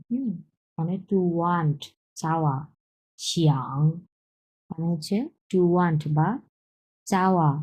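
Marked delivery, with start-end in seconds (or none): 3.2-3.8 low শিয়াং dipping in a V shape; third tone. Shown as a demonstration. Teacher repeats the word for learners, low dipping third tone on শিয়াং. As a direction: dip low on শিয়াং and come back up. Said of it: "তিন number tone".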